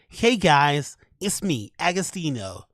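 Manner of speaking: hammed-up American accent. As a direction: put on a friendly American YouTuber voice. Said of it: "Can we hear your American accent?".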